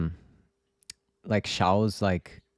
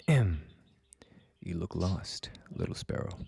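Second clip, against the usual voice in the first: slow, deep villain voice; extremely deep bass voice; 3 semitones lower, 5 dB quieter, much slower than usual. Acted. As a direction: drag it out low and breathy. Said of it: "the deepest voice I could".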